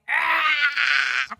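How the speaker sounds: loud, high cry of exasperation; exasperated yell. Retold or imitated. Wife retells being asked to repeat herself, exasperated yell. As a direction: let out a frustrated "Ah!".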